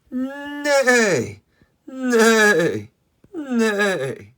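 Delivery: high, loud, falling neigh; whinny the word like a horse. Pretend. Showing kids what horses say, high falling neighs. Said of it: "The horses say".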